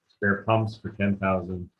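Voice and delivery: usual speaking voice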